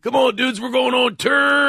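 exaggerated high rock-star rallying cry; mock hyped rocker. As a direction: shout it to the band, hammed-up. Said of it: "[name] doesn't go on tour".

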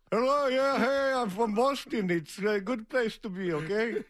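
high-pitched mock Boston caller; Boston accent. Shown as a demonstration. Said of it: "Call in with your best Boston accent".

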